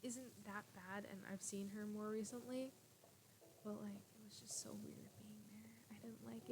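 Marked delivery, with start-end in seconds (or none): 3.6-6.5 soft confiding whisper. Real, not acted. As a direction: whisper it like a secret. Whispered confession about relatives.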